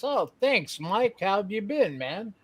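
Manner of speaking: ordinary talk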